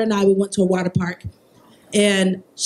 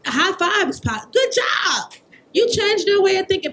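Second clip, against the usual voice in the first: excited praise; 9 semitones higher than usual. Acted. Cheering coworker, good job.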